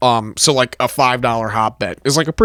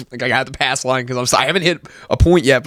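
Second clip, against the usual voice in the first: ordinary talk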